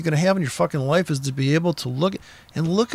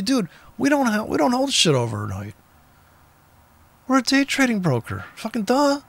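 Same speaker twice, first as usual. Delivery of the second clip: mock broker reply; 7 semitones higher than usual. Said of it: "they go to like".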